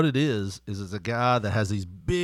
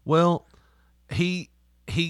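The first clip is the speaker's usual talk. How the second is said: ordinary delivery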